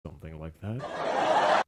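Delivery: deep, slow, chuckling villain line; John answering the life coach question ominously; sinister understatement. Retold or imitated. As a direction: let it roll out low with a knowing laugh. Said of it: "he's like".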